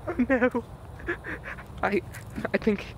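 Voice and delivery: ordinary talk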